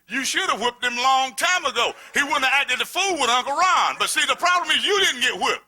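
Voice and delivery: shouted sermon climax; fiery preaching. Acted. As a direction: preach it hard at the crowd. Preaching at full force.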